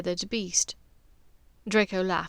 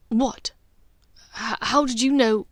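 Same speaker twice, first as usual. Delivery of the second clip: louder, jolted out of his calm; startled disbelief; 3 semitones higher, 6 dB louder than usual. Acted. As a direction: caught completely off guard. Draco startled by her question.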